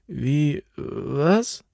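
just woken and not following; startled confusion. A narrator's reading.

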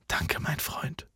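slow, very soft whisper; hushed, breathy and slow; whispered thanks. A narrator's reading. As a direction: thank a friend in a heartfelt whisper. Moved thanks at final parting.

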